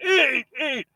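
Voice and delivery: mock child's wail, very high; crying little girl. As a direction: wail the word like a child starting to cry. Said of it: "And starts to cry".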